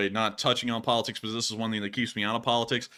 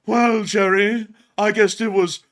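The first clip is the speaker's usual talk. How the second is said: high, slow, loud smug put-on voice; imitating Newman; 8 semitones higher, 7 dB louder, slower than usual. Streamer mocks the mailman Newman, slow and smug. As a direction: drag it out like the mailman making excuses. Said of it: "He's gonna go".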